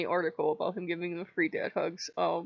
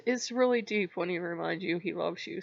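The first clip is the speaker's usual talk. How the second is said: ordinary delivery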